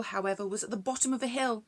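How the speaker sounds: usual speaking voice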